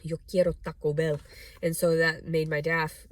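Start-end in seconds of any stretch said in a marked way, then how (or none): 0.0-1.2 Taco Bell dog impression. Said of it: "the Taco Bell dog came out".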